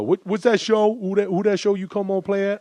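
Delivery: high, overfamiliar fan voice; backslapping fan. Retold or imitated. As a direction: pester a celebrity like an old buddy. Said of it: "slap you on the back and go".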